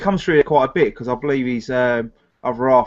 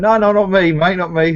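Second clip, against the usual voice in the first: higher, louder jokey denial; playful loud no-no-not-me; mock protest; 8 semitones higher, 4 dB louder than usual. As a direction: protest in fun, loud and high. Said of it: "I went".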